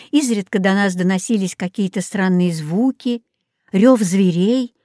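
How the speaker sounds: usual speaking voice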